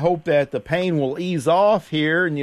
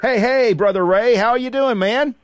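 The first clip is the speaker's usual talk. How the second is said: big bright on-air hello, higher and louder; excited greeting; 8 semitones higher, 5 dB louder than usual. Real, not acted. Host greets caller on air.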